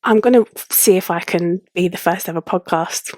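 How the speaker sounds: usual speaking voice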